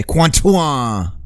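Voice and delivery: hammed-up French say Quantuan with a sweeping fall; mock French. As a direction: pretend the French word for continue. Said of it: "As the French say".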